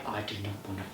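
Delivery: very quiet, low grumble; sullen refusal. Retold or imitated. Refusing to get up for the paddleboard race, sullen low mumble. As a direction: mumble it reluctantly. Reluctant answer to being made race.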